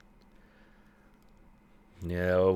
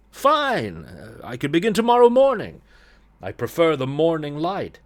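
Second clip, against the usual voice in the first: higher, louder, lightly acted young hero; eager artist; 6 semitones higher, 5 dB louder than usual. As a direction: agree readily, pitched up. Artist's part in voiced dialogue.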